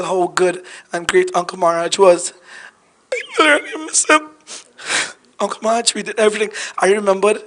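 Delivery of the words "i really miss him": real tearful voice, pitched much higher; choked with grief. Real, not acted. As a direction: fight back tears, voice climbing. Grieving during eulogy for uncle.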